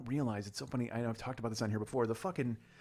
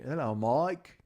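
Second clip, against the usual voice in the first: put-on rough Aussie-style mechanic accent on the phone; mechanic's put-on Australian twang, rising on the name; mock Australian phone greeting; Crocodile Dundee; 2 semitones higher, 5 dB louder, much slower than usual. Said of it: "Crocodile Dundee fixes my car".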